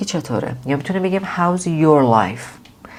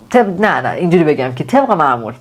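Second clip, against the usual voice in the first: ordinary delivery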